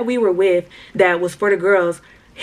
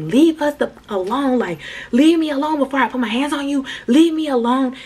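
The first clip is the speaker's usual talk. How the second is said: higher, mock-angry leave me alone; angry retold warning; 5 semitones higher than usual. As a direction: snap at him to back off, raised pitch. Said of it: "So I started getting mad".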